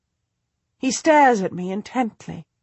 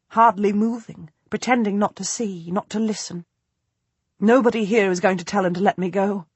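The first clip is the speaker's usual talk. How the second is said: ordinary delivery, nothing marked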